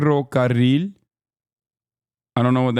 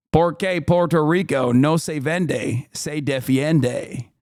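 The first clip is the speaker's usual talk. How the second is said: reading Spanish. Shown as a demonstration. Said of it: "such a gifted linguist".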